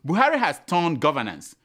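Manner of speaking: normal talking voice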